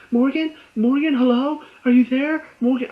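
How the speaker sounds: high, loud anxious calling into a phone; mock Savannah calling. Vlogger imitating Savannah yelling Morgan's name down the phone, high and loud.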